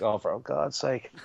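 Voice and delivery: slow, soft, weary groan of a line; exasperated. Retold or imitated. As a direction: let it sag with fed-up exasperation. Locals fed up with brewery requests.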